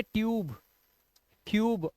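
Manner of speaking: ट्यूब, क्यूब ending on the B sound; silent final E. Shown as a demonstration. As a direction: say ट्यूब and क्यूब, no vowel after B. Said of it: "तो इसे पढ़ेंगे".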